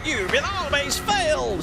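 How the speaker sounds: high, slow theatrical villain shout; cartoon villain voice. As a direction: ham up a Saturday morning cartoon villain. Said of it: "Very Saturday morning cartoon kind of villain voice".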